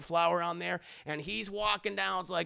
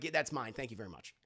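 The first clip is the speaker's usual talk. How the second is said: much lower and softer smug claim; smug quiet; 8 semitones lower, 7 dB quieter, faster than usual. Retold or imitated. The other guy taking the diamond back, low and soft. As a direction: pocket it with a murmur.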